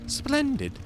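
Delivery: high-pitched game character, effusively grateful. Acted. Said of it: "the attempt at the higher pitched voice".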